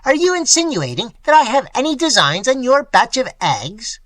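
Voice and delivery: indignant and enraged; passionate fury. A narrator's reading. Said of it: "his face suffused with passion".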